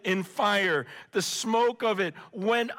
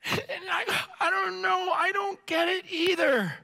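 choked up; 10 semitones higher than usual. Real, not acted. Preacher moved to tears.

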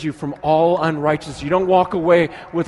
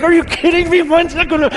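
put-on much higher, louder giddy squeal of a child; giddy kid; 13 semitones higher, 8 dB louder, faster than usual. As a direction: burst out like a kid who can't believe it. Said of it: "like giddy, like".

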